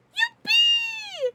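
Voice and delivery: very high loud squeal; a jubilant high scream; shrieked yippee. Real, not acted. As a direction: shriek it with joy. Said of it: "So everyone say".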